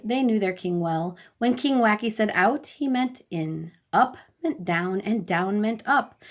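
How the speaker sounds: plain reading voice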